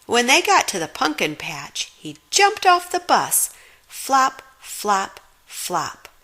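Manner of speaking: usual speaking voice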